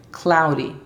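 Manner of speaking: "cloudy" with the D tapped like a Spanish R; "cloudy", its D a light flap close to R; D almost an R. Shown as a demonstration. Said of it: "La D es casi una R".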